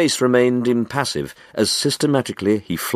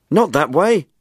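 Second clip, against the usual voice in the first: higher, louder, irritated snap at a fumbling partner; irritable cry; 9 semitones higher, 4 dB louder than usual. Acted. Window cleaner to Jenkinson, correcting his grip, irritably crying out. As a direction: cry out in annoyance, correcting him. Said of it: "cried the window cleaner irritably".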